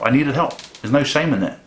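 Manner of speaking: normal talking voice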